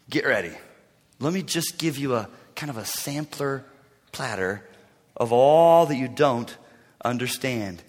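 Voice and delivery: low, soft, deliberate taunt; understated divine sarcasm; challenging God. Acted. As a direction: serve it up with quiet menace. God challenging Job, paraphrased.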